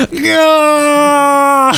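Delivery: high, loud cry of dismay; anguished shout. Retold or imitated. Host reliving Biden's gaffe, anguished shout. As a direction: yell no in horror. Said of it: "And I'm just like".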